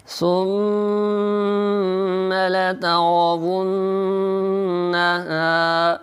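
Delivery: very slow melodic recitation, nasal hum on the doubled nun; slow Quran chant with nasal ghunna. Shown as a demonstration. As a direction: draw out لترون with a humming nasal. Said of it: "Urdu تلفظ".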